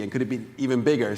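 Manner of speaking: usual speaking voice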